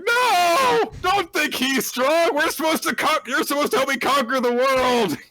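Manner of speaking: put-on very high, loud little girl pleading; squeaky child voice. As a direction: cry out like a frantic small girl. Anya protesting shrilly.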